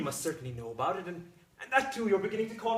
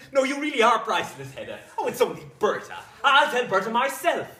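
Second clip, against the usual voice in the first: loud, high, laughing delight; overjoyed outburst; 4 semitones higher, 10 dB louder than usual. Acted. Husband eager to tell the maid the news, overjoyed. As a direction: crow with happiness, barely contained.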